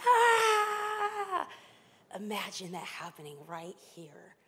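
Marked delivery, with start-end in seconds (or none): 0.0-1.5 a short, high mock shriek; a hammed-up spirit's cry; demon shriek. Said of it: "came out with a shriek".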